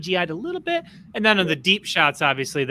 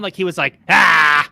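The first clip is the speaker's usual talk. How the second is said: roaring shout; 6 dB louder, slower than usual. Retold or imitated. Rancor roars at Cad Bane.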